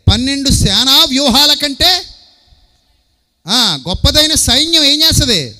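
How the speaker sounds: mighty proclamation. Acted. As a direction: thunder out the size of the army. Voices Jesus describing mighty army.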